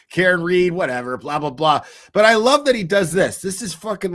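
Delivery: normal talking voice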